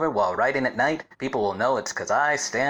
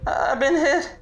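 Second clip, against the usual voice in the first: shouting for help; much slower than usual. Acted. Shot detective screaming for help in the street, panicked high yell. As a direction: yell out in panic after being hit.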